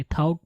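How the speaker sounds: "थाउट" with an ow glide, "thowt"; mispronounced thought. Retold or imitated. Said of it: "थाउट बहुत से लोग पढ़ते हैं". ("थाउट" غلط تلفظ، "تھاؤٹ")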